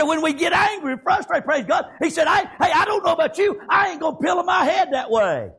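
ordinary talk

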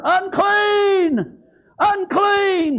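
very high, drawn-out cry; hollered warning. Acted. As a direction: holler it as lepers warning off passers-by. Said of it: "they're hollering".

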